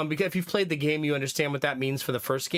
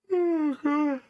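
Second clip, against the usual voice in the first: big yawn; 11 semitones higher than usual. Real, not acted. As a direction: let out a real tired yawn. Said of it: "That's my first yawn of the night".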